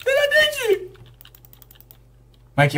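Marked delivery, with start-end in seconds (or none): 0.0-0.9 very high, frightened cry; high-pitched shriek of alarm; panicked squeal. Real, not acted. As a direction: squeal in real panic at being trapped. Trapped, door will not open.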